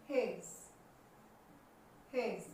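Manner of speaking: "हेज" as hez, no D; silent D. Shown as a demonstration. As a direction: drop the D of hedge in "हेज". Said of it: "डी silent रहेगा". ("हेज" में डी silent)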